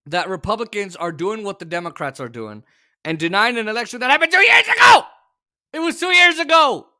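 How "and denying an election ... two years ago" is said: loud, high-pitched frustrated yelling; exasperated shout. Real, not acted. Host yelling at election-denying Republicans, exasperated shout. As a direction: blow up at the absurdity. Ranting about election deniers.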